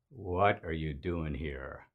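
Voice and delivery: low, soft, slow hostile voice; a suspicious Klansman at the door, menacing and quiet; man behind the door sizing up a stranger, low and slow; mock Klan leader. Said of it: "imaginative rendition".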